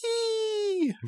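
piercing high monster screech; gargoyle shriek. A narrator's reading. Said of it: "gives a terrible shriek".

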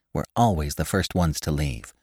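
ordinary delivery

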